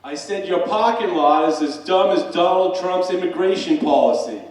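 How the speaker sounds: loud, high, acted defiance; defiant shout. Professor repeating his insult to the barman, loud defiant shout. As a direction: fling the insult back at full volume.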